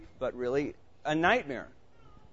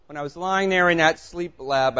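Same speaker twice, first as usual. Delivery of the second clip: ordinary delivery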